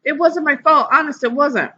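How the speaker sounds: put-on high pleading girl; high tearful plea; crying. A narrator's reading. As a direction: protest through tears. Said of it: "Sheila cried".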